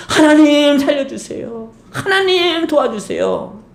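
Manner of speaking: high, slow, pleading cry to God; desperate plea. Acted. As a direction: beg God slowly, voice lifted high. David's desperate prayer acted out.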